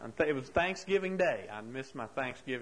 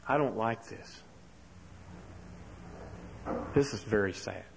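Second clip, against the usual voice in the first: lower, subdued and mildly sad; sad Big Bird; 5 semitones lower, 4 dB quieter than usual. Retold or imitated. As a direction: let Big Bird sink into quiet sadness. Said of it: "And then Big Bird said".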